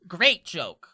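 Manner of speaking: loud, mirthless laugh and dry retort; mock the joke with a forced laugh; sarcastic imp. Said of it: "He rolls his eyes".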